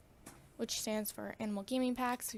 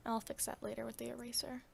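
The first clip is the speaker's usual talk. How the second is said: whispering; 5 dB quieter than usual. Real, not acted. Aside to self while drawing.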